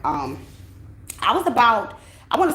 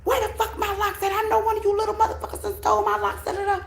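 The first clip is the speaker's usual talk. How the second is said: much higher, fast accusing mock mom; imitating angry mother. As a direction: ham up mom yelling about her locks. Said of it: "she over here accusing us".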